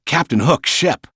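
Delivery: ordinary delivery, nothing marked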